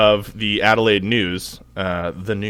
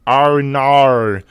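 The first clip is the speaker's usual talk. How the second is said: mock TikTok meme; 4 semitones higher, 8 dB louder than usual. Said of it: "the viral TikTok".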